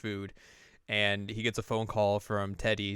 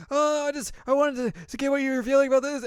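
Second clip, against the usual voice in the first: put-on very high, louder, fast flustered girl; nervous high voice; 7 dB louder than usual. Chie trying to confess, very high and flustered. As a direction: blurt it out nervously.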